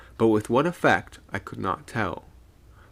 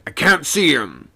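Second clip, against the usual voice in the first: eager call, loud and raised; 6 semitones higher, 8 dB louder than usual. A narrator's reading. Second mate straining to spot the figure, calling eagerly. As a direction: call it up keenly. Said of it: "asked the second eagerly".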